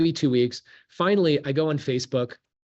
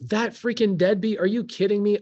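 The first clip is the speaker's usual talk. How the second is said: mock outrage, pitched up high; indignant ex; 6 semitones higher than usual. Mother venting about the deadbeat father, mock indignant. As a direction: voice her scorn for the deadbeat dad.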